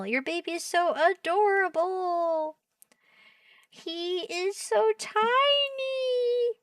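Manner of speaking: very high, stretched-out cooing; adoring coo. Real, not acted. Streamer texting family about their baby, cooing high. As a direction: gush in a drawn-out squeal.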